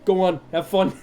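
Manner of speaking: New York host sending guests off; cheery New York send-off; New York accent. Acted. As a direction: shoo them along like a New Yorker. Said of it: "We're gonna do New York".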